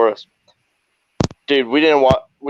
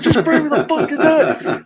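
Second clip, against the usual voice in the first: high, laughing through the words; laughing order. Retold or imitated. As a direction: bark it while cracking up.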